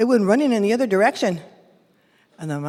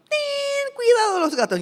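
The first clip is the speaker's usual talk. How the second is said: parents warning; 12 semitones higher than usual. Acted. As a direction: sing out the warning to the kids.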